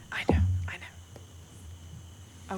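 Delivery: whispering laugh. Acted.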